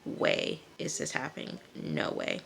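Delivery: slow, soft imitated disbelief; stunned disbelief. Jay watching the panic, quietly stunned disbelief. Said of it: "Jay is looking like".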